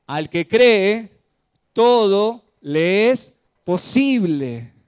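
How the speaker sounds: loud, slow, declaimed line for the congregation to repeat; call-and-response sermon declamation; slow proclaimed refrain. Shown as a demonstration. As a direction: lead the church in saying it, slow and strong. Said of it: "¿Te animás a decirlo conmigo?".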